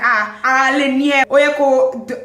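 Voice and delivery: loud, high Yoruba protest; mock loud complaint. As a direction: imitate an aggrieved driver, loudly. Retold driver's Yoruba complaint.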